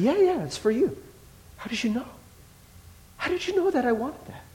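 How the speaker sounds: high, hushed disbelief at the gift. Retold or imitated. Grateful friend asks how you knew, softly amazed. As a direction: pitch it up in wonder.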